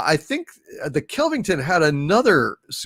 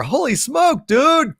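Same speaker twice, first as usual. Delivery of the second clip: amazed; 11 semitones higher, slower than usual. Real, not acted. Amazed at a big super chat.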